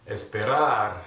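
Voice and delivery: Esperar spoken clearly, stress on the last syllable; model pronunciation. Shown as a demonstration. Teacher models Esperar for students to repeat. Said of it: "You ready?".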